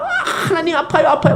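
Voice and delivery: very high, laughing mock cry of pain; mock whimper of pain. David crying to God that the beating hurts, playfully. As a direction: whine like a punished child. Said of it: "다윗이 아파가지고 막".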